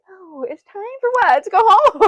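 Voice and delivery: retold bewildered question, pitched well up; mock confusion. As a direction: play it dumb and baffled, climbing up. Confused reply to nurses, retold.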